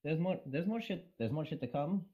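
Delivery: amateur Scottish accent attempt; bad Scottish accent. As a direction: have a go at a Scottish lilt. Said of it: "answer in a Scottish accent".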